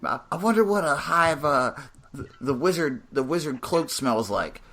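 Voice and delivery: cracked rambling vagrant; Drifter voice. Retold or imitated. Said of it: "the Drifter voice going".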